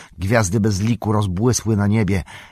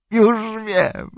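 much higher and louder, bursting with discovery; laughing cry; 4 dB louder than usual. A narrator's reading. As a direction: shout it as the answer hits you. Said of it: "Gandalf się śmiał".

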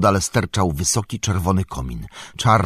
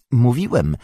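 higher, louder admission, torn between fear and curiosity; torn, trembling; 8 semitones higher, 6 dB louder than usual. A narrator's reading. Sam admits to Galadriela his wish to see magic, nervously eager. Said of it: "drżąc w rozterce między strachem a ciekawością".

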